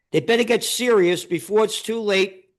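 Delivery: ordinary delivery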